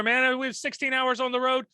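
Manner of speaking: ordinary talk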